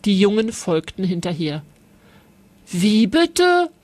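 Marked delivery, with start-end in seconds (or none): 2.7-3.7 Elias protesting he didn't start it; outraged boy, hammed-up a little; scolding. A narrator's reading. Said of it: "schimpfte Elias".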